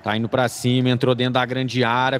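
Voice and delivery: fast radio match call; live play-by-play. Acted. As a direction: narrate the attack live.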